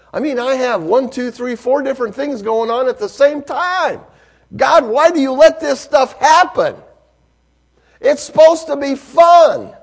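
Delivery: loud, high sermon build; full-force preaching. Acted. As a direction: preach it with rising passion.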